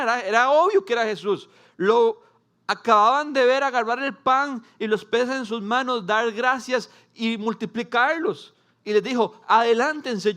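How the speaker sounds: loud, high, fervent preaching; preaching at full force. Acted. Pastor building excitement before the congregation, loud and fervent. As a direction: drive it home to the crowd.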